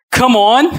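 high, loud rising shout; loud hype shout. Real, not acted. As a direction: rouse the whole room. Preacher calling again, stronger.

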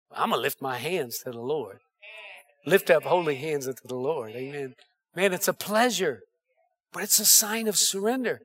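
soft, low and intimate; almost under his breath, as if confiding; hushed. Real, not acted. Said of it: "quiet testimony".

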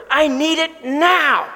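high and slow, pressing hard; desperate demand. Retold or imitated. Homeless father shaking down his debtor, desperate plea. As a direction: plead it out, urgent and strained. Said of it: "because he's desperate".

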